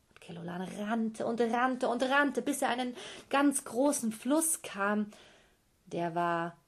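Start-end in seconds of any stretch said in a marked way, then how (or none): none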